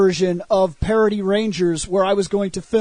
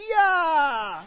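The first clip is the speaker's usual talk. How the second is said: high strained cries; hammed-up battle shouting; mock fight yells; 5 semitones higher, much slower than usual. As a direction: yell like fighting off in the dark.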